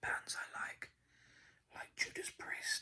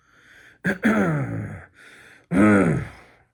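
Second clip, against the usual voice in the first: long, loud throat clear; 23 dB louder than usual. Real, not acted. ASMR host interrupting his own impression, loud throat clearing. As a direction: clear the throat noisily and at length.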